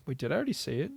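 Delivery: usual speaking voice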